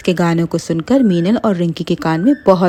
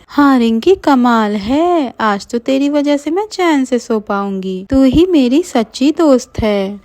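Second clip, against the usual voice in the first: put-on high, relieved girlish voice; grateful friend; 6 semitones higher than usual. Meenal thanking Rinki, high and relieved. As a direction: thank her sweetly. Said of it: "Meenal कहती है".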